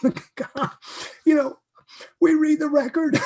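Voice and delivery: choked up while reading scripture; real emotion breaking through; emotional. Real, not acted. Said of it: "I'm getting emotional".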